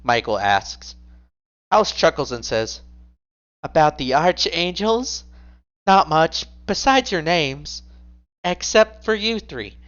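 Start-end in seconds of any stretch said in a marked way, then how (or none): 3.6-9.8 higher smug woman voice. Acted. House answering with a chuckle.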